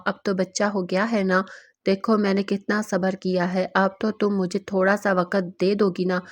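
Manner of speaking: ordinary delivery, nothing marked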